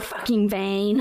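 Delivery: normal talking voice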